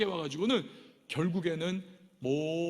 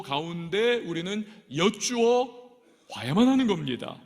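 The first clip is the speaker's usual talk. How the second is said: loud, higher preacher's exhortation; fervent preaching; 4 semitones higher, 7 dB louder than usual. Acted. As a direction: drive the point home to the congregation. Preaching at full force.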